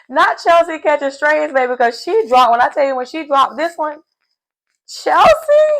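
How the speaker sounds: high, loud, fast gleeful shriek; shrieking excitement. Real, not acted. Host squealing that Chelsea caught a stray, high gleeful shriek. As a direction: squeal it like juicy gossip landing.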